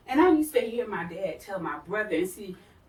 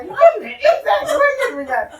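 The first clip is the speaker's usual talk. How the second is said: laughing disbelief; 13 semitones higher, 11 dB louder than usual. Retold or imitated. Retelling reaction to pastor's remark.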